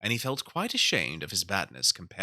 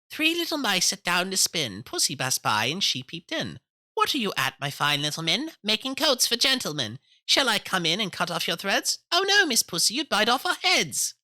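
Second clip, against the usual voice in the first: high, loud sing-song mouse voice; 11 semitones higher, 5 dB louder than usual. A narrator's reading. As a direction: chant it brightly like tiny mice. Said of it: "tried another tune".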